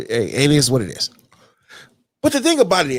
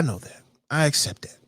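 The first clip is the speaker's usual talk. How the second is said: softer, whispered admission; 4 semitones lower, 6 dB quieter than usual. Real, not acted. Admitting his weight to himself, whispered. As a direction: drop to a whisper. Quiet self-admission aside.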